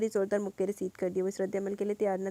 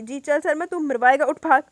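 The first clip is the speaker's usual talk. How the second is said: loud, higher, frantic urging; panicked shout; 5 semitones higher, 13 dB louder than usual. Acted. Tony yells at injured friend to flee, panicked. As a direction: yell at him to get up and run.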